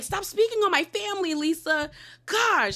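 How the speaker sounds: high pitched, hammed-up exasperated plea; a lightly acted tantrum; mock whiny outburst. Recapper voicing Bronwyn scolding Lisa, high mock exasperation.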